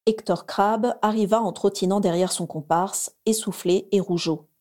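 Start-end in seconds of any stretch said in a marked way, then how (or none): none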